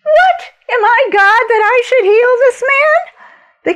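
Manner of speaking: high, loud, indignant outcry; the king protests that he cannot heal; upset king. Retold or imitated. King reads the letter and cries out, high, loud and outraged. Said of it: "The king was really upset".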